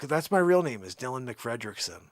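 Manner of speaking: normal talking voice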